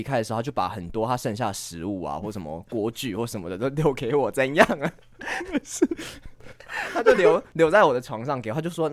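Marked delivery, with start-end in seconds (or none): 5.2-6.9 airy unvoiced laugh, rising; breathy laugh. Real, not acted. Breathy laugh while telling friends about roommate. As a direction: let a breathy laugh slip.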